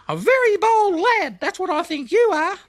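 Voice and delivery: a tiny creature fussing grandly; chirpy cricket. A narrator's reading. Cricket stopping Blinky on the path, very high and squeaky.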